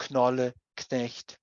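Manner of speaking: "knolle, knecht" with the k sounded before n; German words "knolle, knecht", both opening consonants heard; German kn cluster. Shown as a demonstration. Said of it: "or the kn in".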